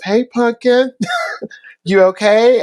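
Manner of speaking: high, light, caring voice with a laugh; Bruno's gentle check-in. Retold or imitated. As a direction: coax a sad kid kindly. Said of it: "and he goes".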